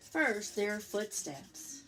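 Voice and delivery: usual speaking voice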